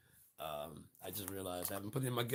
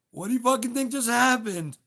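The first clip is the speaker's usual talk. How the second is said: high and loud, exasperated; angry yell; 13 semitones higher, 11 dB louder than usual. Real, not acted. To the audience about fake choice, an angry yell. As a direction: yell it at the crowd in disbelief.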